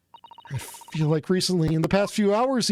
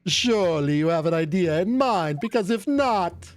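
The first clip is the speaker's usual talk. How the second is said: prosecutor voice; 3 semitones lower than usual. Acted.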